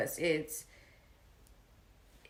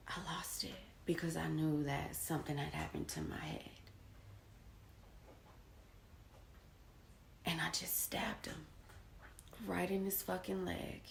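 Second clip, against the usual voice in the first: whispered, much softer confession; hushed; 12 dB quieter, faster than usual. Real, not acted. Man recounting the stabbing to viewers, whispered. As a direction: drop to a whisper as you admit it.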